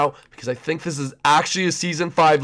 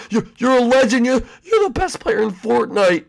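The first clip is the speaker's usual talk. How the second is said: usual speaking voice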